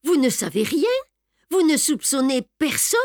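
high, urgent questioning; crying out. A narrator's reading. Beautrelet challenges the baron, crying out his questions. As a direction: press him with rising questions. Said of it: "s'écria Beautrelet".

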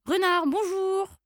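high, drawn-out greeting; singsong little mouse hello; cheery mouse call. Acted. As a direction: call out slowly to the fox. Mouse calls out to fox.

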